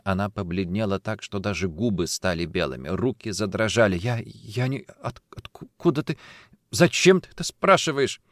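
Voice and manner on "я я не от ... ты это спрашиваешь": halting, shocked, rising in pitch; shaken, stumbling questions; frightened stammer. Acted. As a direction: stammer in fear, then demand to know why. Pale, hands trembling, confronted.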